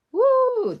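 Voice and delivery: high, loud cheer; excited whoop. Real, not acted. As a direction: let out a happy whoop at the result. Celebrating a stamped leaf.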